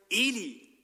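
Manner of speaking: put-on young Samuel calling out, high and loud; calling out for Eli. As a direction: call out to the old priest. Samuel calls, woken from sleep. (ruft laut nach Eli)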